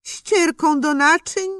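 mother spots the dish towel, horrified cry. A narrator's reading. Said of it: "zawołała ze zgrozą".

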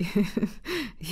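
short laugh, lightly voiced; a father's amused laugh. A narrator's reading. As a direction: chuckle at the tiny boy's idea. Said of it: "Ojciec zaśmiał się".

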